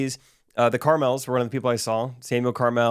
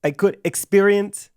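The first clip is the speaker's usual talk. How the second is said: high, slow put-on German accent; mock German accent; 8 semitones higher, 5 dB louder, slower than usual. Imitating the German student with a slow, high German accent. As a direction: stretch out "I could experience" like a German teen. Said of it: "can you give me a German accent".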